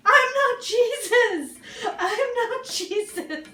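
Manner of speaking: high, drawn-out mock yell with laughter; retold yelling. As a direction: replay the drugged protest slowly, laughing, fading on the repeat. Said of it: "And I started yelling".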